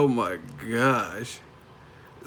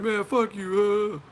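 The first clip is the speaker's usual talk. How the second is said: mock defiance; 10 semitones higher, much slower than usual. Acts out an instant angry comeback.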